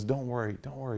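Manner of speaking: very soft, hushed comforting; gentle reassurance. Retold or imitated. Father wiping his crying daughter's tears, softly reassuring. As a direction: soothe softly, almost under the breath.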